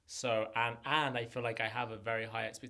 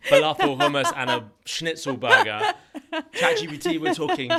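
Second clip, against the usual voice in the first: laughing loudly and much higher, slowing down; 15 dB louder than usual. Real, not acted. Guest mocking a nutritionist's fried food, laughing hard. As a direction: giggle the words out as you mock the menu.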